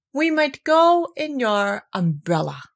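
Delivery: slow, thoughtful bear; slow Pooh voice. A narrator's reading. Pooh offering his idea, slow and thoughtful. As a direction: ponder it out. Pooh's clever idea, first take.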